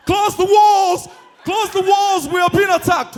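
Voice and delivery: high, loud shouted warning to the whole town; alarm cry. Acted. Sentry warning the town of an attack, shouted alarm.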